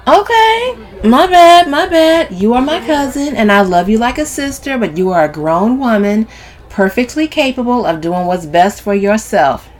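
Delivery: sassy placating speech; exaggeratedly apologetic Camille. A narrator's reading. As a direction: back off loudly, then reason with emphasis.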